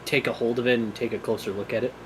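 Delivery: ordinary delivery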